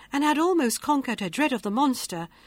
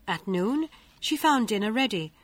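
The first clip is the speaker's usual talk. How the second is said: plain reading voice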